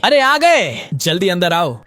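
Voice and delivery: loud, high welcoming call from inside. A narrator's reading. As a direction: shout a cheerful welcome from another room. Voice calling from inside house. (अंदर से ऊँची आवाज़ में खुशी से पुकारना)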